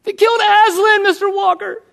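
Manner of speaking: put-on high, tearful child's voice; a young girl close to tears; crying little girl. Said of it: "she was about to cry".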